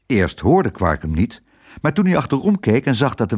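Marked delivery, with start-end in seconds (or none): none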